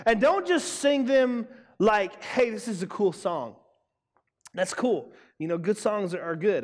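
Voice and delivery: ordinary delivery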